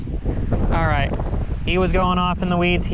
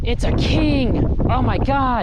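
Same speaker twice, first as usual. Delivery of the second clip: excited; 8 semitones higher, slower than usual. Real, not acted. Sudden find of a king snake.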